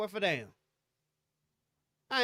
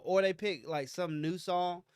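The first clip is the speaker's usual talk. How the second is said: ordinary delivery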